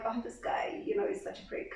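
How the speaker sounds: soft, unvoiced aside; whispered gossip. Colleagues moaning about their tough editor, whispered. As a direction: whisper it so the boss can't hear.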